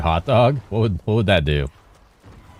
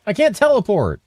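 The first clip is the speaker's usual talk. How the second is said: high frustrated yelp; 12 semitones higher than usual. Real, not acted. Frustrated game outburst.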